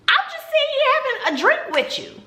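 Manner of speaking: high, exasperated "I'm just sitting here having a drink with you"; incredulous. Retold or imitated. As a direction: squeak it out in disbelief. Exasperated retort in retold date.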